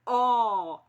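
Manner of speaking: loud, long, wide-open "ó". Shown as a demonstration. Teacher showing pupils that the sound opens up, long open "ó". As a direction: belt out a stretched open "ó". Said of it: "é bem aberto".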